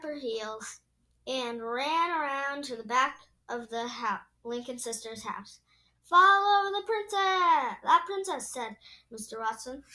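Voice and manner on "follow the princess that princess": loud, high rallying call. Acted. Rallying others to follow the princess.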